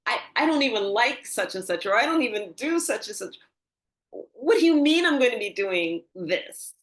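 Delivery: re-enacted baffled disbelief; disbelieving protest. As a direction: protest as if the idea is absurd. Acting out her disbelief at prediction.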